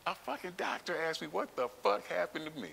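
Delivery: high-pitched, incredulous indignation; outraged disbelief. Retold or imitated. Storyteller reliving the doctor's rude question, outraged disbelief. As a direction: repeat it in scandalized disbelief, pitch way up.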